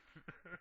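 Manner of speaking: a quiet little laugh under the other's talk. Real, not acted. Host reacts to friend's weekend boast, chuckling quietly. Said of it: "Listen to him laughing".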